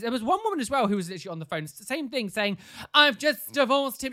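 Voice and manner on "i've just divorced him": loud, slow wail of a divorce; mock sobbing. Shopper on the phone sobbing about her divorce. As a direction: sob it out slowly like a wronged wife. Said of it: "sobbing in front of me".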